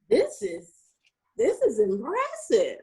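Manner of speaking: high, halting put-on awe; mock impressed stranger. Stranger reading a dropped resume on the elevator, mock impressed.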